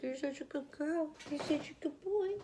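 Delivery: high, soft doggy coo; imitating the high voice for dogs; dog-talk voice. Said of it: "it's always like".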